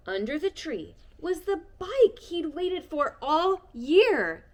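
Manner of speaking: slow, high, loud reveal; excited reveal. A narrator's reading. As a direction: announce the surprise with wonder. Reveal of the bike.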